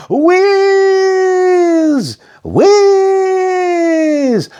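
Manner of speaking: high drawn-out whiz; long whiz. A narrator's reading. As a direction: sing out the speed. Sound of whizzing.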